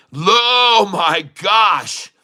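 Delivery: high outburst of amazement; astonished cry. Real, not acted. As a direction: cry out in real disbelief. Astonished at the chart.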